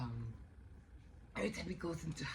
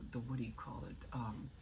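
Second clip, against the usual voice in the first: ordinary talk, nothing marked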